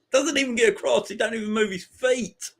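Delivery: high-pitched, exasperated disbelief; incredulous complaint. Real, not acted. Fan fuming at Mings's defending, incredulous complaint.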